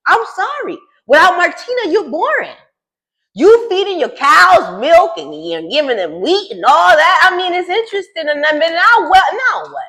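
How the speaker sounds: high, sassy mock apology; roasting. Real, not acted. Vlogger roasting boring Julia in a high, sassy voice. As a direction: rag on her with high-pitched sass.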